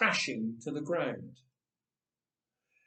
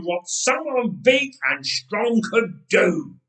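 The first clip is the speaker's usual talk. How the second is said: giant's rage; 4 semitones higher, 8 dB louder than usual. Acted. Goliath's raging thoughts while charging.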